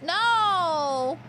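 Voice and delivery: loud high no; shouted no. Real, not acted. Streamer reacts to a game event, loud shout. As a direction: cry out in dismay.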